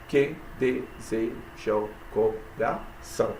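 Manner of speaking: "Que deseja o coração" slowly split into syllables. Shown as a demonstration. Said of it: "sete sílabas". ("Que deseja o coração" devagar, separando as sílabas)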